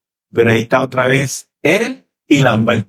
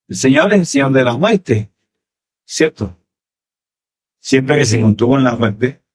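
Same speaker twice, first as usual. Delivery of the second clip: ordinary talk, nothing marked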